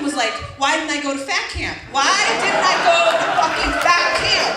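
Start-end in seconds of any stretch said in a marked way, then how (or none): 1.9-4.6 exasperated outcry. Retold or imitated. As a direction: wail the question in loud regret. Escalated repeat of her lament.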